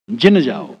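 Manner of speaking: "जिन" said with a short i, as in the local speech; dialect pronunciation. Shown as a demonstration. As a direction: say "जिन जाओ" the way people there say it. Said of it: "जन को फिर जिन कर देते हैं". ("जिन" का देहाती उच्चारण)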